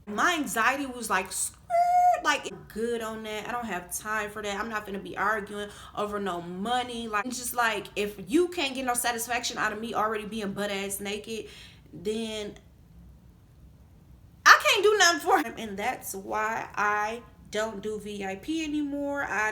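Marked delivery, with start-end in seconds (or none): 1.3-2.2 very high, loud tire screech; shrieked skid noise. Retold or imitated. Imitates tire screech for anxiety's reaction. 14.5-15.5 sudden loud, high exclamation; high-pitched, loud punchline; exasperated outburst. Real, not acted. Exasperated punchline after a long pause.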